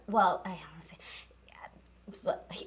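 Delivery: very soft, trailing, reluctant to tell; coy hushed aside. Real, not acted. Podcast host holding back about her crush, hushed. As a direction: drop to a slow near-whisper.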